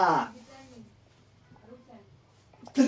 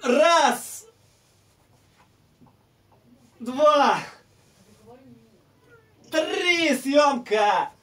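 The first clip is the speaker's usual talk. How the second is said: grunting counts under heavy load; straining shout; 8 semitones higher, 4 dB louder, much slower than usual. Real, not acted. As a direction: force each count out with effort. (с натугой, выкрикивая)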